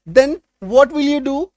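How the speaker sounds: very slow English question with a rise; slow read of an example question; slow model sentence. Shown as a demonstration. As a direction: dictate the question slowly. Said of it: "तो तुम क्या करोगे?".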